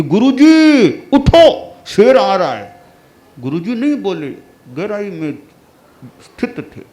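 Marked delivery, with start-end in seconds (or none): 0.0-2.8 loud, high shout to wake him; urgent shout. Acted. Disciple shouting to wake the meditating guru, loud and high. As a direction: yell a warning that a lion is coming.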